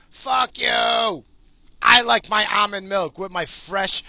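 high, loud ranting defense of his coffee; loud defiant rant; defiant shouting. Real, not acted. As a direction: shout down the mockers. Defiant rant about almond milk.

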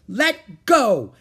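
high, loud defiant yell; shouting. A narrator's reading. Annie into the pirate's face, defiant shout. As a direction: shout it right in his face. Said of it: "Annie shouted into his face".

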